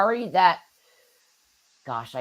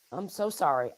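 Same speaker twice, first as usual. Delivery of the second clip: hoarse, fading voice; strained raspy voice; raspy whisper; 7 dB quieter than usual. Real, not acted. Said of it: "I'm losing my voice".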